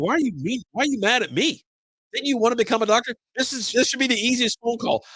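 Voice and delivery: high-pitched, pleading retold complaint; aggrieved. Patient protesting to the rude doctor in retelling, aggrieved.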